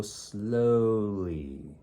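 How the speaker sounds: drawn out slow and deliberate; slowly. Acted. Line leader refusing to race, slow proud drawl. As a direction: stretch every word out.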